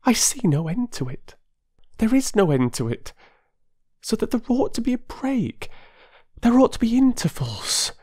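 high, rushing, pleading voice of an excitable wife; eager Mrs Wilkins. A narrator's reading. Mrs Wilkins urging a stranger to escape, eager and high.

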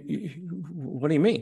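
ordinary delivery

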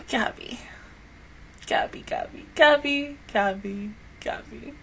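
a soft, hushed repetition of the name; grieving whisper. Real, not acted. Fan mourning the character, whispering her name. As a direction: murmur her name to yourself.

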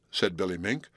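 plain reading voice

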